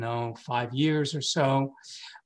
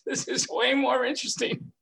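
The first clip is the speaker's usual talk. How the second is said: delighted laugh; 10 semitones higher than usual. Retold or imitated. Laughing after energy bolt.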